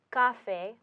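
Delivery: café said as CA-fe; stress shifted forward. Shown as a demonstration. Teacher showing the would-be normal pronunciation, café stressed on CA. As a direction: stress the first syllable of café. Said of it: "the normal pronunciation would be".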